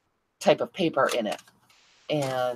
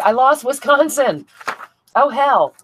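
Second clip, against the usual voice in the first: higher, louder cry of dismay, slowing on Wisconsin; real dismayed outburst; 10 semitones higher, 7 dB louder, slower than usual. Real, not acted. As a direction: blurt out that you lost it. Loses map state on screen.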